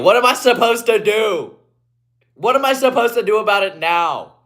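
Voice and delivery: usual speaking voice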